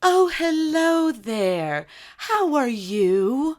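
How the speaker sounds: high, slow, sing-song robot; sweet droid voice. Retold or imitated. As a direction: greet them like a gentle droid. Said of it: "she had this very".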